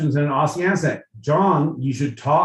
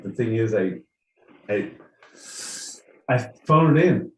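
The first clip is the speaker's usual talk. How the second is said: normal talking voice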